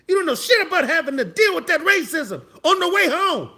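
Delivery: high, loud, worked-up tirade; worked-up, emphatic outrage; heated comic rant. Pretend. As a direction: rant indignantly at clueless youngsters. Mounting comic rant at young people.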